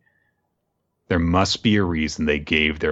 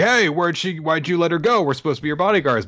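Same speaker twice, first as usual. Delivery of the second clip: mock yell; 9 semitones higher, faster than usual. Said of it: "starts to yell at Carlos".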